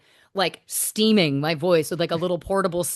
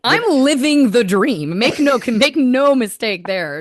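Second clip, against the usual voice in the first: louder, slow, ironic boast with a laugh; mock grandiose irony; 5 semitones higher, 7 dB louder, slower than usual. Real, not acted. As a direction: oversell it as the glamorous life, tongue in cheek. Joking about quiet tour life.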